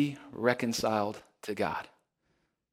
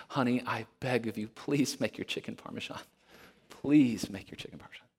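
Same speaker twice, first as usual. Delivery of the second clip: softer, whispered pleading; desperate begging; 6 dB quieter than usual. Retold or imitated. Husband begs wife for chicken parmesan in whispered desperation. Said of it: "such a desperation in that cry".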